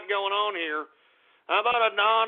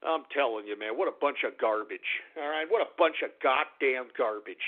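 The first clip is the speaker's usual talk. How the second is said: low, subdued grumble; deflated and disgusted; weary disgust; 10 semitones lower, 6 dB quieter than usual. Real, not acted. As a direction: grumble wearily after blowing up. Disgusted after the outburst.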